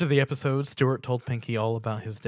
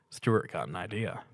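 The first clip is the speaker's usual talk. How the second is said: ordinary delivery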